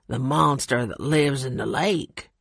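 lightly voiced gruff mountain man; low-voiced Carl. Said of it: "said Carl".

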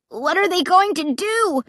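high, frightened cry; a scared kid crying out; terrified cry. A narrator's reading. Little brother cries out at monsters, terrified. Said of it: "Clay cried".

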